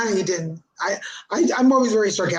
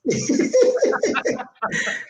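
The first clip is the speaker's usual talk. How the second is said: high unvoiced laugh; 13 semitones higher than usual. Real, not acted. Laughs at frozen guest.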